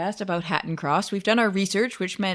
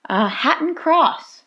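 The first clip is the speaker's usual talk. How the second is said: dramatic reveal; 10 semitones higher, 3 dB louder, much slower than usual. Real, not acted. Dramatic reveal of drawn station.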